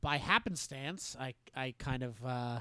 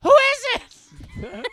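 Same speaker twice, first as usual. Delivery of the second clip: much higher, slow, called across the house; distant call back; 13 dB louder, much slower than usual. Retold or imitated. Girl asking her mom who called, calling out. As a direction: call out from another room.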